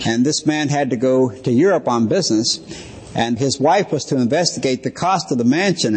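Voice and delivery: usual speaking voice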